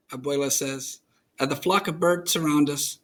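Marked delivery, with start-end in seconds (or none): none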